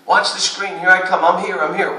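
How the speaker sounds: loud, high calls of a talking defender; calling out on defense. Retold or imitated. Coach showing his players how to call out the screen, loud and high. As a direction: shout the screen calls to teammates. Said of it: "why would you not be".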